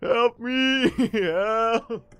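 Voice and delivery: high, loud comic cries for help; hammed-up desperate yelling; mock scream. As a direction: wail it like the video guy. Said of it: "he just starts screaming".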